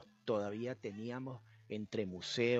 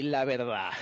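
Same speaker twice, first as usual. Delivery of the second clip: laughing; 6 dB louder than usual. Real, not acted.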